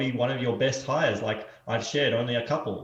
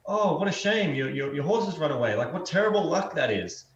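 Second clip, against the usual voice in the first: higher, louder mock sympathy of a neighbor; pitying neighbor; 5 semitones higher, 4 dB louder than usual. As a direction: play the neighbor clucking over bad luck. Neighbors voiced in parable.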